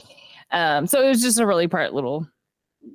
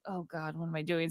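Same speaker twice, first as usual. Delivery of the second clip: much softer, low, embarrassed under the breath; a retold mortified teen, far softer; mortified murmur; 4 semitones lower, 12 dB quieter than usual. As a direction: mutter it to yourself, mortified. Embarrassed son realising mistake.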